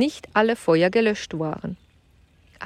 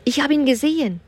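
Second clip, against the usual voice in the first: stammering; 7 semitones higher, faster than usual. Acted. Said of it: "stammelte Kim".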